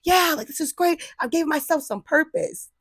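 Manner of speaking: high-pitched, giddy pride. Retold or imitated. Imitating herself on a high about her purpose. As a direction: gush about your own idea. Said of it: "I was like, on this high".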